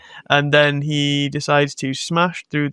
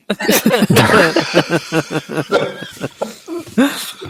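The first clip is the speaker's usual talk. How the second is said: real hearty laugh; 4 semitones higher than usual. Real, not acted. Podcaster cracking up at own joke, hearty laughter. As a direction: crack up, higher and on and on. Cracks up after mock speech.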